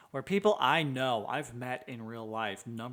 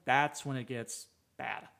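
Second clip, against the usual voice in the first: ordinary delivery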